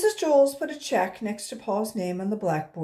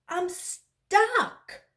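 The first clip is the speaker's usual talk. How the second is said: calling out; 11 semitones higher, 5 dB louder than usual. A narrator's reading. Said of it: "he called".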